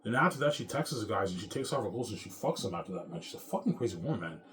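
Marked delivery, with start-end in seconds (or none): none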